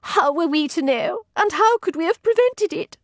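high, pleading reassurance; consoling lady's maid. Acted. Maid comforting the other, high and consoling. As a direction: plead that nobody could have known.

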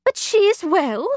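high, worried question; anxious woman's voice. A narrator's reading. Mrs Lane voiced in story.

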